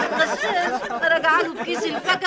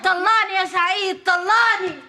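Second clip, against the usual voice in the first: demanding shout; 5 semitones higher, 6 dB louder, slower than usual. Acted. Wife demanding divorce loudly.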